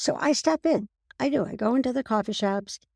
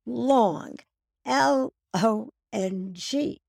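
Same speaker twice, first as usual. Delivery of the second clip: "Long" pitched up, then slow separate letters; impatient spelling out; 4 semitones higher, much slower than usual. Retold or imitated. Customer corrects drive-through order, impatiently spelling it out. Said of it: "a little more than a slight impatient tone".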